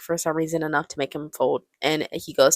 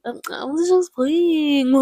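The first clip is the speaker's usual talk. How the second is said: exaggerated high whine, drawn out and loud; whiny; 10 semitones higher, 5 dB louder, slower than usual. Ben backs down to Mrs. Robinson, hammed-up high whine. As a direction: whine it slowly like a scolded kid. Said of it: "in this whiny ass voice".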